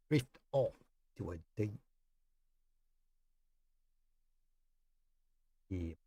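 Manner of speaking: soft and fading, a different accent; hushed sleepy drift. Shown as a demonstration. Streamer tests headphones, hushing chat to sleep. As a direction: lull the listener off to sleep. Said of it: "say it in a different accent".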